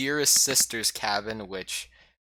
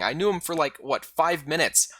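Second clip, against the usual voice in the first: usual speaking voice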